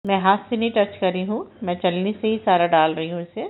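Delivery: ordinary talk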